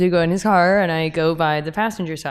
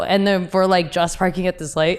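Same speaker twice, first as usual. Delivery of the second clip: ordinary talk, nothing marked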